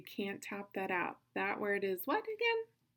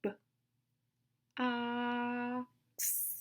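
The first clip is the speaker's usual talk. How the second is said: short "B", pause, long held "ox"; super slow sounds; 3 semitones higher than usual. Shown as a demonstration. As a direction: sound out "B, ox" very slowly, vowel stretched. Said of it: "say it one more time".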